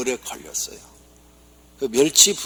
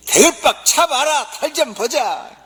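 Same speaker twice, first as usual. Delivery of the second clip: far higher and louder, slow dialect bellow; gruff Gyeongsang husband; 13 semitones higher, 8 dB louder, slower than usual. Acted. Gyeongsang husband mocking wife's big head, loud dialect. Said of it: "경상도 남편이".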